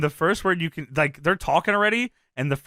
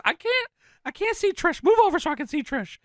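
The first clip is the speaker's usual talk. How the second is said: high-pitched whining complaint; Jerry Lawler; 13 semitones higher than usual. Acted. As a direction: squeal it like an exasperated commentator. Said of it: "Lawler complains about his monitor".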